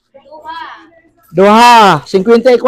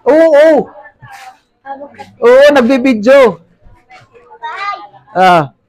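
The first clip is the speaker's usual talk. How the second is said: ordinary delivery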